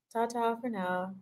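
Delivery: quiet trailing "Ta-ta for now"; soft goodbye. Shown as a demonstration. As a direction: let "Ta-ta for now" fall away as a soft aside. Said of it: "playful goodbye repeated".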